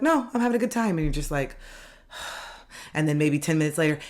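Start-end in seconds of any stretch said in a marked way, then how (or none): 0.0-1.0 high, breezy reply; cheerful friend. Retold or imitated. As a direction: brush off the question lightly. Friend answers.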